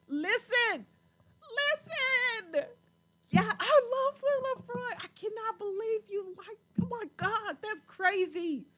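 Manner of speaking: high, loud burst of delight; excited gushing. Real, not acted. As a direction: exclaim like you found a fellow fan. Gushing over a shared favorite whisky.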